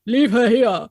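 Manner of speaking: put-on a loud, dramatic line in a Hawaiian accent. Husband reading her story aloud in a Hawaiian accent. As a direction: ham it up like a pro voice actor with a Hawaiian accent. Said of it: "like, Hawaiian accent".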